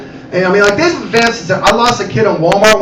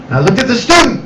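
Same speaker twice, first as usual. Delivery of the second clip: usual speaking voice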